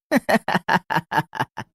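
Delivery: evil laugh. Acted. Ghost laughing at the trapped family, evil laugh. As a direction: laugh darkly.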